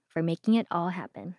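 ordinary talk, nothing marked

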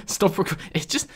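ordinary talk

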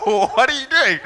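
high, loud mock Indian accent; badly faked Indian manager, laughing through it. Indian manager questioning his absent employee, badly faked high Indian accent. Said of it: "he was an Indian fellow. I can't, I can't do the accent".

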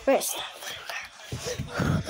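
breathless whisper; whispered question. Real, not acted. Kid wondering about candy, whispering. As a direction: whisper it to yourself.

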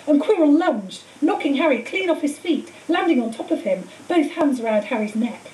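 plain reading voice

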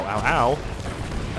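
ordinary talk

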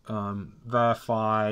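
usual speaking voice

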